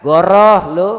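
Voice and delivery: goroh lu thrown out loud with a rise at the end; mocking loud call-out of a liar; loud Betawi retort. Shown as a demonstration. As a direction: scoff goroh lu loudly at the liar. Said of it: "Bahasa kitanya tuh, Pak".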